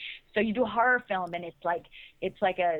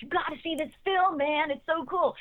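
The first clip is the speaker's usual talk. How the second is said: higher-pitched, eager recommendation; enthusiastic college student; 6 semitones higher than usual. Retold or imitated. Imitating college students passing the tape around, higher excited pitch. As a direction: imitate a student raving to a friend. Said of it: "and was like".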